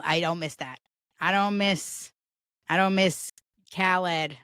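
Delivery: normal talking voice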